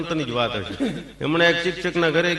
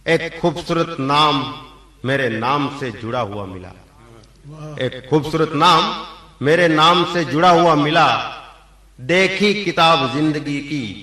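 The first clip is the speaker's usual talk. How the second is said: shayari recital; 5 dB louder, slower than usual. Acted.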